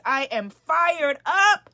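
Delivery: loud, slow, rising rally cry; fired-up shout. Shown as a demonstration. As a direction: pump up the crowd on fired up. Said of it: "Type it in the comments".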